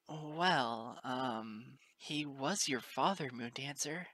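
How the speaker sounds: ordinary delivery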